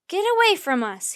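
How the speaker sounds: high loud shout. A narrator's reading. Jack shouting at the closing crowd. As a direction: shout them back. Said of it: "he shouted".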